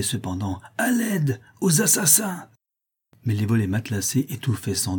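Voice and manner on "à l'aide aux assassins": high, strained cry for help; desperate cry for help. Acted. Buridan, wounded and outnumbered, cries desperately for help. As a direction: scream for rescue as you're overpowered. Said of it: "d'une voix désespérée, criait".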